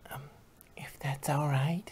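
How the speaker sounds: soft, breathy trailing ask; timid whisper. Acted. Shy pony asking the pop star, timid whisper. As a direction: hide behind the words, barely voiced. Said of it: "Fluttershy replied timidly".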